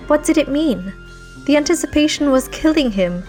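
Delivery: plain reading voice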